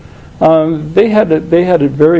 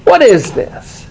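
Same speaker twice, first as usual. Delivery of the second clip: slow, raised indignant question; slow, higher, affronted question; indignant protest; 5 semitones higher, slower than usual. Acted. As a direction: demand it like a wronged son. Older son protests to father.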